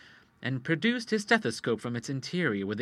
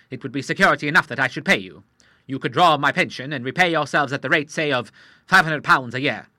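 put-on proud old Admiral; 3 semitones higher, 8 dB louder than usual. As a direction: state your credentials like a commander. Admiral voice held.

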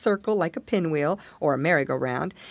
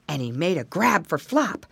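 usual speaking voice

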